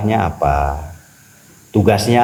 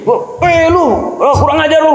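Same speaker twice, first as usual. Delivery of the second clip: high-pitched, loud mock-angry yell; indignant outburst; 3 dB louder than usual. Ordinary person snapping at whoever jostled them, indignant outburst. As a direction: flare up at someone who bumped you. Acts out angry reaction to a bump.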